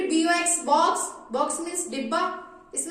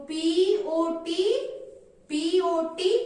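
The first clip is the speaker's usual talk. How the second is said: slow spelling; much slower than usual. Shown as a demonstration. Teacher spelling pot for pupils, very slow. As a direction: spell P O T very slowly. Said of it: "pot की spelling क्या होती है".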